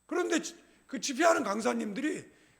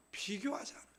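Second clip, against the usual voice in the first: breathy whisper of the answer; 3 semitones lower, 10 dB quieter than usual. Shown as a demonstration. Preacher whispering the answer to the congregation. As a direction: whisper it as a prompt.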